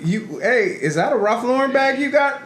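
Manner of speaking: high, eager teasing question; excited gossip. Retold or imitated. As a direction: point it out with a big high voice. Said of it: "And they're like".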